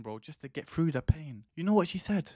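normal talking voice

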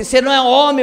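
wife's scolding shout, acted out; retold yelling. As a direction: imitate a wife yelling an accusation. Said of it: "quando ela tava gritando".

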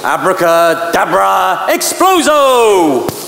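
slow drawn-out magic spell; kids party magician. Pretend. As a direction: cast it like a stage magician. Mock magic spell before experiment.

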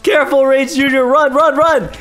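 urgent alarm. Real, not acted. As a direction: urge your companion to flee. Urging companion to flee.

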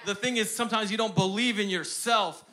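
fiery sermon climax; preaching. Acted. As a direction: drive it home to the congregation. Preaching at full force.

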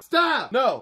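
sudden sharp high yelp; yelling stop. Real, not acted. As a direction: cry out as it hurts. Dog stepping on him.

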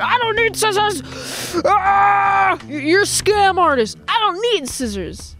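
mock outrage, pitched high; indignant refusal. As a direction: act out an exaggerated protest at a scam. Acts out refusing the peddler's scissors.

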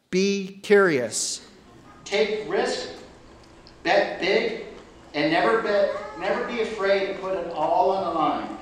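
ordinary talk, nothing marked

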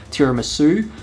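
ordinary talk, nothing marked

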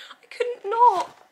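very high, slow and loud; high loud giddy gush; giddy squeal. Real, not acted. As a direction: squeal it, helpless with delight. Gushing over cute pug.

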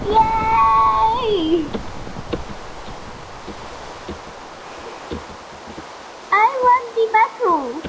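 high happy cheer; excited. Real, not acted. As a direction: squeal with victory. Wins the battle.

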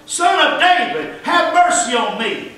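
high, slow drawn-out plea; insistent crying out. Acted. Blind man defying those silencing him, crying out insistently. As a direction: cry it out slowly, starting high. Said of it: "he cried out all the more".

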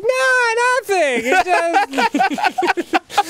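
high, cracking denial; high squeaky backpedal. Retold or imitated. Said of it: "he's like".

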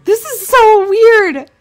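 high, loud disbelieving cry; real alarm as the game talks back; freaked-out shriek of surprise; shocked. Real, not acted. Streamer spooked by creepy game.

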